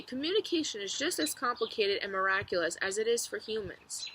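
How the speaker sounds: ordinary delivery, nothing marked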